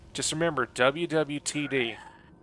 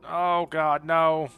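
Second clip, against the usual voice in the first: loud dismay; 4 semitones higher, 5 dB louder, much slower than usual. Real, not acted. Dismay at a wrong turn.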